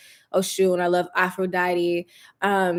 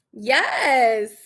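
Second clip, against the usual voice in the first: ordinary talk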